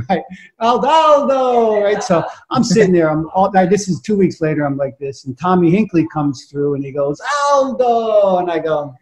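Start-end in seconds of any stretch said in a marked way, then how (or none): 0.6-1.8 high, slow mock yell; yelled name. Repeating the gallery prank every half hour, drawn-out mock yell. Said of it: "I'm gonna yell". 7.5-8.4 high, drawn-out mock announcing yell; yelled name. Prankster calls a missing artist's name at gallery, mock yell. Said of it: "I'm gonna yell".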